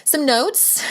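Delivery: jokey put-on Irish accent; weird Irish accent. Shown as a demonstration. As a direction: toss off "Some notes" in a playful fake Irish lilt. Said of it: "my weird Irish accent".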